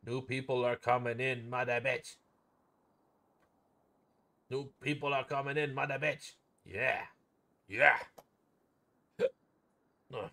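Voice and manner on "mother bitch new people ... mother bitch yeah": normal talking voice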